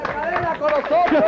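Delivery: high, thrilled host call; excited announcement. Acted. Announcing guest arrival.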